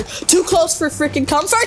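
rising alarm. Real, not acted. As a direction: let alarm creep upward. Enemy closing in.

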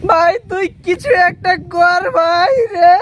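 hammed-up wailing plea; tearful pleading. Husband begging his friend to help, tearful pleading. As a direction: beg through sobs, dragging the end. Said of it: "তুই আর চিন্তা করিস না".

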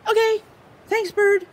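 high, fast, frantic little squirrel voice; excitable squirrel. Acted. Squirrel frets over his missing leaf, high and anxious. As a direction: play the squirrel, jumpy and loud.

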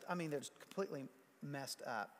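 ordinary talk, nothing marked

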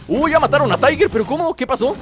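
high startled cries; high shocked disbelief. Real, not acted. Tiger killed suddenly.

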